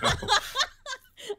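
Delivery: high, loud cracking laugh; burst of laughter. Real, not acted. Cohost laughing hard at a friend's voice, loud high laugh.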